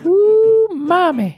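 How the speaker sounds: high, loud squealed ooh mommy; high mock squeal. Squealing with delight at the sprinkled donuts.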